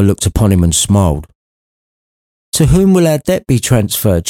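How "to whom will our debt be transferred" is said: mother's voice. A narrator's reading. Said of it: "she asked".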